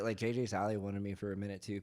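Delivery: ordinary delivery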